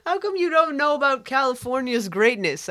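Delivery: high, wailing mock protest; mock indignation. Host defending California's greatness, mock indignant. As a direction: plead it high, in mock hurt. Mock scolding of other states.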